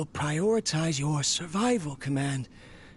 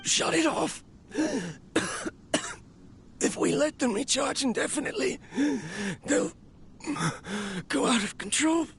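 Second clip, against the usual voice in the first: desperate cry; 8 semitones higher than usual. Acted. Collapsing inventor begging the robot to stop the extractor, panicked. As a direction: shout it out in a frantic rush. Urgent warning as machines run wild.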